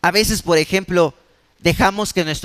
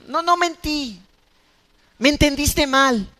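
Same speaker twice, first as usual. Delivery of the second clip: a light put-on voice of someone brushing off a lie; mock excuse; 7 semitones higher than usual. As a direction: play down the lie, half-joking. Pastor mimics excuse-making liar. (voz burlona de quien minimiza su mentira)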